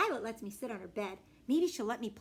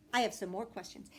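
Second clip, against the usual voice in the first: usual speaking voice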